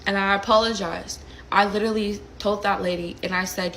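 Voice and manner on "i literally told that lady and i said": ordinary delivery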